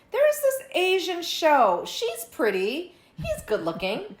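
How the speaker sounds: high, slow, drawn-out musing; imitating intrigued viewer. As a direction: voice a curious browser slowly talking themselves into it. Acts out viewer discovering show.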